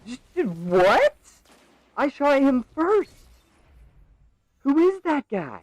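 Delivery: high, loud, slow outraged yelling; raging gamer. Real, not acted. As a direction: rage at the screen in disbelief. Raging at an enemy player.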